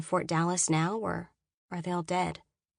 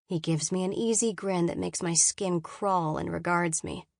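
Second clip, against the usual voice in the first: usual speaking voice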